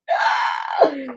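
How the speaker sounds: sharp dismayed intake of breath; startled gasp. Real, not acted. Losing the tape again, a sharp gasp. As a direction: gasp as it dawns on you.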